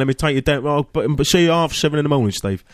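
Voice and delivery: put-on a cheery London cabbie accent, lightly done; London driver accent. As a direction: chat like a London driver offering a lift. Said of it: "if you were a driver on a film set".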